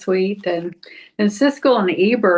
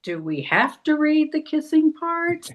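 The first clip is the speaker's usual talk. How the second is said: put-on higher, complaining little boy; mock whiny kid; 6 semitones higher than usual. Imitating the grandson grumbling at his grandpa. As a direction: whine it like a bored grandson.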